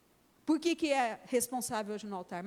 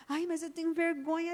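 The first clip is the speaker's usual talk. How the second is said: put-on higher childish whine, embarrassed; shy little girl; 6 semitones higher than usual. Little daughter voiced in story. (voz de menininha envergonhada, mais aguda)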